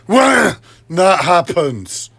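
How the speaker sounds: high, loud, very drawn-out outburst; real exasperation. Real, not acted. Upset that something must be wrong, exasperated. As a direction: stretch it into a strained cry of frustration. Escalating repeat in frustration.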